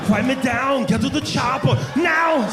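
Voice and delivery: high, drawn-out action-hero shout; urgent movie catchphrase, stretched out; Arnold Schwarzenegger. Acted. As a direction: yell it as a thick-accented action star. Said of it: "you're gonna do that".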